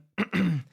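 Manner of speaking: rough throat clear. Real, not acted. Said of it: "Sorry about the voice again".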